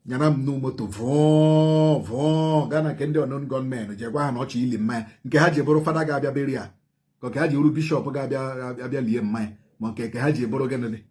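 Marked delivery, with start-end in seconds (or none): none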